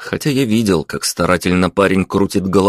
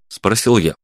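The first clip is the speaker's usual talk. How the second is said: usual speaking voice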